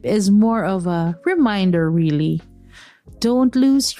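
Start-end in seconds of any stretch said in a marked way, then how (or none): none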